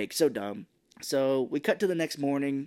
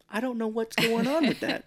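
high puzzled mock voice; comic bafflement, pitched up; Mark Wahlberg; 9 semitones higher than usual. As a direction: muse aloud in a silly high register. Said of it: "he's like".

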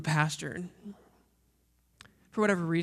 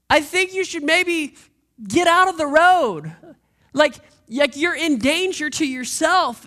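loud high yell from the roadside; yelling to someone; 10 semitones higher, 13 dB louder, slower than usual. Retold or imitated. Pastor yelling at a blindfolded person on the highway, loud high shout. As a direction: call out over traffic, high and loud. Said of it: "and I yell to you".

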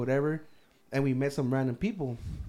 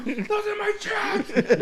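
high, slow, laughing yell after a thief; mock yell; 3 dB louder, much slower than usual. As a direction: holler after the thief, cracking up. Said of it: "fat guy yelling at the smoke".

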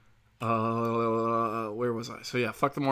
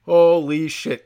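slow, higher and much louder holy shit; stunned outburst at celebrities' tweets; louder exclamation; 4 semitones higher, 9 dB louder, slower than usual. Retold or imitated. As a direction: drag out "Holy shit" loud and high, stunned. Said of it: "I was like".

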